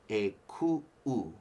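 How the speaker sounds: "ku-u" split by a sharp catch in the throat; glottal stop demo. Shown as a demonstration. Teacher shows students the break inside "ku-u". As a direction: attack the second "u" of "ku-u" after a break. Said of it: "putting a break".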